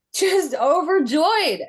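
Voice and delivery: ordinary talk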